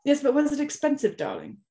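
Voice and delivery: high then dropping mock snob; posh luvvie quote. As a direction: sniff about the price like a socialite. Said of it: "and be like".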